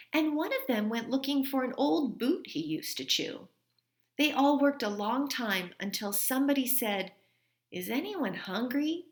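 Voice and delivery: gentle read-aloud storytelling voice; story reading. A narrator's reading. Teacher reading a story to her class, gentle read-aloud. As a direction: read it like a teacher sharing a picture book with kids. Reads a picture book aloud.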